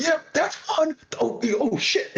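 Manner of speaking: high excited call then oops; hyped fan. Retold or imitated. Big hyped fan calling kills by accident, high excited. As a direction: blurt the kill call and catch yourself. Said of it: "this big guy goes".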